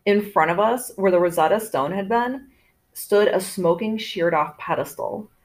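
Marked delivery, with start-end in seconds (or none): none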